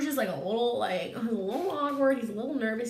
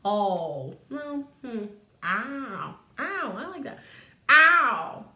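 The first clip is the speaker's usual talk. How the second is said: trying out Scrooge voices; 4 dB louder, much slower than usual. Acted. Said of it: "I don't like that voice for Scrooge".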